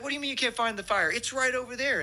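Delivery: high, barking yell of a fire chief; mimicking the chief's shouted commands. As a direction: yell orders at the crew. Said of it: "He's like".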